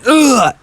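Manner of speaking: loud, high mock vomit noise; loud fake "ugh" of puking; imitated retching. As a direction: act out throwing up with a sudden heave. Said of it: "throwing up, going".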